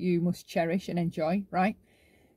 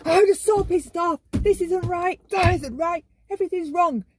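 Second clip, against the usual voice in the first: high, loud mock screaming; hammed-up outrage, pitched way up; histrionic anger; 8 semitones higher, 5 dB louder than usual. Shown as a demonstration. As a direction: overact being furious in a shrieky wail. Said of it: "whereas when they're showing you pissed off".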